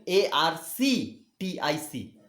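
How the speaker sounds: A R C T I C spelled out slowly, each letter separate; careful slow dictation of A R C T I C. Shown as a demonstration. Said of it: "so remember the spelling".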